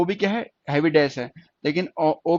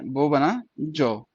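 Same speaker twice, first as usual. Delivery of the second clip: drawn-out dictation; 2 semitones lower, much slower than usual. Shown as a demonstration. Teacher draws out the word.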